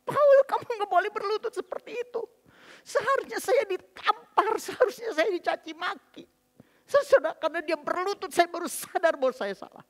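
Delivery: high, loud crying plea; high, anguished confession; tearful remorse. Real, not acted. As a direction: plead through tears, blaming yourself. Tearful remorse, begging husband to rise.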